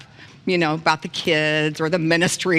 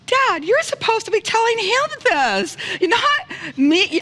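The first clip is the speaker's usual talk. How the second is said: high, loud, laughing protest; 5 dB louder, slower than usual. Retold or imitated. Daughter laughing back at her dad. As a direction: laugh through the objection. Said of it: "I'm like".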